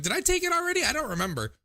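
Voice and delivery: high-pitched self-questioning, falling off; acting out forgetful self. Acting out forgetting whether the pills were taken, high-pitched self-questioning. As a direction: play up the muddled second-guessing. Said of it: "I'm so bad at being like".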